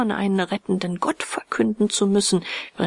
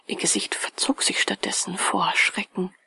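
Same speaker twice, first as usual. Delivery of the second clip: plain reading voice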